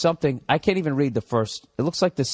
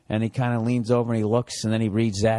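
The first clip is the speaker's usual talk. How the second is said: ordinary talk, nothing marked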